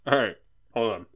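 talking through a mouthful of nuggets; words round a bite of chicken; mouth full. Real, not acted. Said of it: "I got a mouthful of chicken nuggets".

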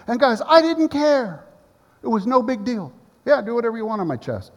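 high, loud, excited telling. Real, not acted. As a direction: let the joy burst out. Excited recounting to congregation.

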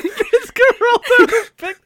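words dissolving into laughter; laughing. Real, not acted. Friends recalling a film, laughing helplessly. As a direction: laugh through the name.

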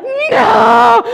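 put-on high, loud toddler wail; toddler's scream. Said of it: "she's like".

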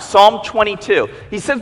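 normal talking voice

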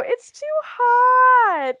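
high, loud, long-drawn imitation of a whine; mock whining complaint. Imitating two contestants refusing the ocean, hammed-up whine. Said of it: "Haruka and Corey both go".

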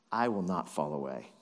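low-pitched vow after a big boast; understated swagger; bravado. Acted. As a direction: drop low for a cocky promise. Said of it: "don't you love this bravado".

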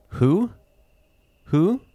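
high, hooting "Who?" like an owl; playful owl impression. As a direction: hoot it out as a joking owl. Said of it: "It's my owl joke".

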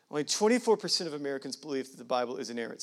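fast, matter-of-fact repeat; rapid, low-key recap; quick restatement. Real, not acted. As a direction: run through it briskly in one breath. Same statistic repeated quickly.

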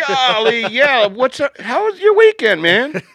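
very high, loud, excited host voice; over-the-top greeting. Pretend. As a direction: hype up the greeting. Mock cheery podcast greeting.

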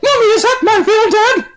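put-on high, laughing kid voice; mock child asking mom; whiny kid. Son voice, high.

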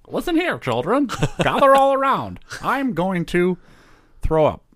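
high silly cartoon voice; goofy voice. Mock voice bit.

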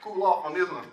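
bail out with a big polite goodbye; loud awkward exit. Retold or imitated. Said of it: "And I was like".

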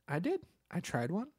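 ordinary talk

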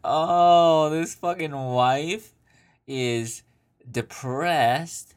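loud, slow, scornful outburst; mock exasperation. Real, not acted. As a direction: drag out the scorn. Mocking outburst at letter writer.

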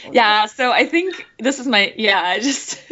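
ordinary delivery

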